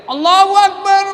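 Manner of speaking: high loud called-out takbir; shouted takbir. Retold or imitated. Muballigh relaying the call, high and loud. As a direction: call it out to the back rows. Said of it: "Ada yang teriak".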